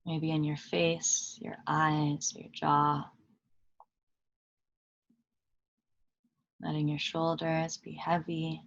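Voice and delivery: calm meditation guide with long pauses between phrases; unhurried, even voice settling listeners into stillness; guided meditation. Acted. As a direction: lead them gently inward, leaving long silences.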